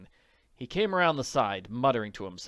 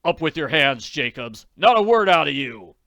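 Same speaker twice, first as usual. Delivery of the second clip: barked holdup order, louder; a firm gunpoint command, loud and clipped; stern command; 3 semitones higher, 11 dB louder than usual. Acted. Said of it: "to emphasize his command".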